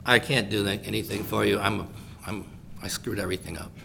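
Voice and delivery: low, soft, defeated mumble; mock dejected. Imagined Peter refuses the lame beggar, acted defeated and low. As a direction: shrink back, low and quiet.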